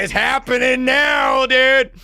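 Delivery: high, hyped shout; giddy excitement. Acted. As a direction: shout it high like the fight just broke out. Excited as fight begins.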